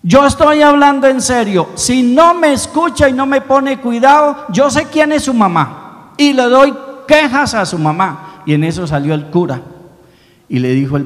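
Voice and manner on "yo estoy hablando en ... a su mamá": put-on a child's angry shout, much higher and louder; angry boy. Boy loses patience with the statue, angry child's shout. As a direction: scold the statue like a fed-up kid.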